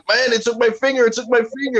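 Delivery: put-on high, panicky child's wail; hysterical kid. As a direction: plead like a terrified little boy. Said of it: "And I'm like".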